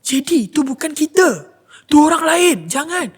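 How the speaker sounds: high, loud and urgent; frightened warning. Retold or imitated. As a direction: warn her in alarm. Friend warns her, frightened.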